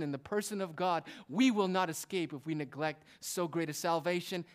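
loud, high, urgent sermon delivery; forceful preaching. Real, not acted. Preacher warns the congregation they will not escape, forceful preaching. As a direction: preach it hard, driving the warning home.

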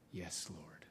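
soft, low, whispered reverence; hushed whisper. Acted. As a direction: draw them in with a near-whisper. Hushed whispered close of sermon.

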